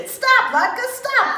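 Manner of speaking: high loud shout. A narrator's reading. The boys at the latkes, high loud shout. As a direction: yell it across the street. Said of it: "they shouted".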